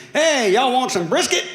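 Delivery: loud, high, folksy "Hey, y'all want some brisket?"; booming down-home greeting; Southern blessing. Retold or imitated. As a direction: call it out like a hearty Southern host. Blessing friends at synagogue.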